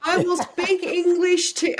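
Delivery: high, loud, slow talk-to-a-foreigner voice; mock callers. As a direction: call out slowly and loudly, as if to someone with little English. Imitating callers talking to a foreigner.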